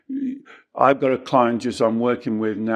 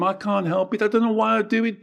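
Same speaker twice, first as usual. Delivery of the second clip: high, fast whining self-pity; parodying someone playing the victim; mock whiny victim; 10 semitones higher, faster than usual. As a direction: rattle off excuses in a higher mock-helpless voice. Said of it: "You see how you're the victim?".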